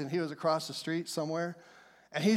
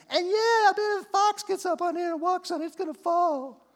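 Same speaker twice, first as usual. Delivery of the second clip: much higher, mocking cartoon sidekick voice; silly sidekick voice; 11 semitones higher than usual. Preacher voicing Tobiah jeering at the wall, high silly voice. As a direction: play Tobiah as a snickering lackey. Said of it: "I don't know if he sounded like that".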